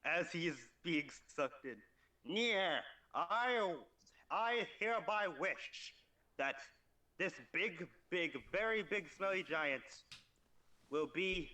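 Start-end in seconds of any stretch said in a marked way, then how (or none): 2.2-11.5 higher, drawn-out skeleton voice; slow theatrical skeleton with raised pitch. Acted. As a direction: intone the wish like a skeleton. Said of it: "in the most skeleton voice possible".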